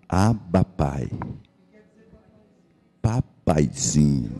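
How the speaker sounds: soft, slow, intimate plea to a father; tender prayer. Retold or imitated. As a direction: whisper it as a child to his dad. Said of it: "ele falou assim". (oração terna, baixa e lenta)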